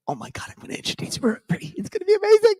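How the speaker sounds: breathless then squealing way up high; gushing excitement. Retold or imitated. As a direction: squeal it like you can't contain yourself. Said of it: "I was like".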